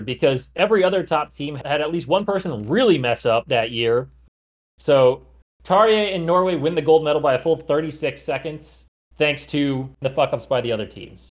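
ordinary delivery

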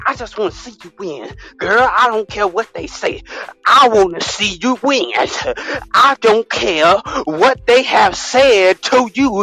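mock preaching. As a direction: whip the congregation up like a fired-up preacher.

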